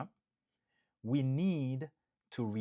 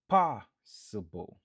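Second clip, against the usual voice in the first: Possible with POS said louder; stress on first syllable; 8 dB louder, slower than usual. Shown as a demonstration. Teacher drilling the stressed syllable loudly. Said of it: "nou aksantye sou premye".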